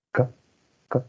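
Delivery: "K" said twice as a bare sound; k sound demo. Shown as a demonstration. Teacher asking students to make the "K" sound. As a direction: let students hear "K" at the back of the tongue. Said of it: "hagan el sonido K".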